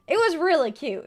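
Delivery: delighted gush. Real, not acted. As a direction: gush over it. Excited recall.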